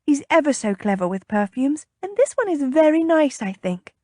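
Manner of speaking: high, coaxing sales patter; enticing. A narrator's reading. Lady persuading the captain to try perfume, enticing. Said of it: "she said enticingly".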